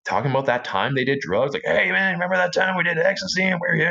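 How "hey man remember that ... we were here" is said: a higher, lazy stoner-buddy voice; a hint of a druggie friend reminiscing; mock stoner buddy. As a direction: lightly imitate a buddy reminiscing about ecstasy. Mimicking friends' drug talk.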